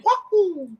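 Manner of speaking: high squeaky cartoon Mario cheer; bouncy video game plumber cheer; Mario wahoo. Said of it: "instead of saying".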